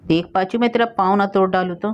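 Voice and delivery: plain reading voice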